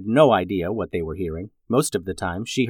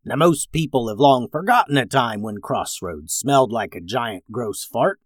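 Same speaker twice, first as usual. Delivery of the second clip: ordinary delivery, nothing marked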